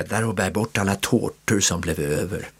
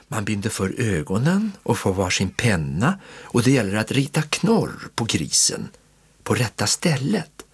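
usual speaking voice

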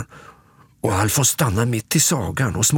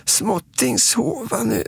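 put-on high little toddler voice; Småtting; 12 semitones higher than usual. Småtting has had enough of monster stories, high child voice. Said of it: "säger Småtting".

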